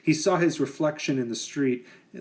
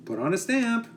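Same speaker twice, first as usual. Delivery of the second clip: mother's voice; 9 semitones higher than usual. Acted.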